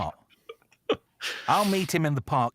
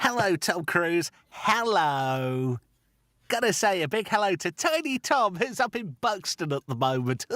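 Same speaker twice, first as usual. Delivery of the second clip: higher, hammed-up DJ greeting; mock radio shout-out; 9 semitones higher than usual. Radio host heckling Tom Cruise, mock DJ shout-out. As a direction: call out cheekily to get his attention. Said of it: "I kept saying, uh, inflammatory comments".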